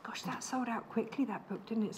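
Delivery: hushed aside whisper. Real, not acted. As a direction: whisper it off-mic.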